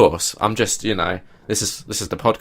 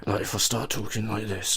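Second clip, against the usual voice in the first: slightly changed voice; 11 dB quieter than usual. Shown as a demonstration. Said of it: "change my voice a little bit".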